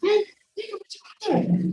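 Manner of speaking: high, imitating grandma threatening doctors; mock threat in a high voice; agitated grandma. Granddaughter imitates delirious grandma threatening doctors, high mock threat. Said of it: "ela pegou o tubo e ficava assim".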